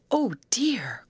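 high, drawn-out cry of dismay; mildly fearful wail, falling at the end; frightened cry. A narrator's reading. Grace shrinking back against Betty, frightened cry. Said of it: "cried Grace as she shrank back".

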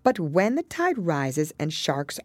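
high, measured singsong verse; poem recitation. Acted.

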